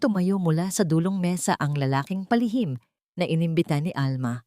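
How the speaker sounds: usual speaking voice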